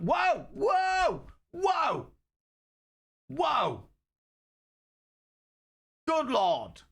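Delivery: very high, drawn-out falling whoas; mock astonishment. Streamer buried in popcorn, mock astonishment. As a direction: cry out high, as if overwhelmed.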